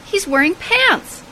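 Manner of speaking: ordinary talk, nothing marked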